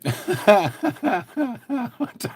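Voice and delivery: high chuckling laugh; a long run of real chuckles; laughter. Real, not acted. Streamer reacting to his chat, laughing.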